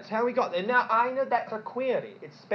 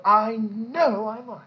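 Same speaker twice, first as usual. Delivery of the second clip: mock self-assured claim; 8 dB louder, slower than usual. Voicing the self-sure infallible ones.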